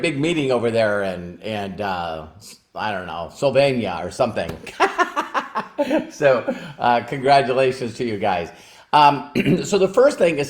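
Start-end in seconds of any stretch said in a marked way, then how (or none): 4.5-6.0 short high laugh; high chuckle. Real, not acted. Laughing off a garbled place name, high laugh. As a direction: laugh at your own mispronunciation.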